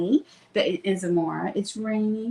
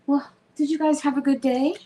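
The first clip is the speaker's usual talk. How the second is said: ordinary delivery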